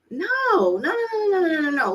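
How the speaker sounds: high-pitched string of no's; emphatic refusal. Acted. Mom refuses to bash the father to her son, high and insistent. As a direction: cut off that idea with high, rapid no's.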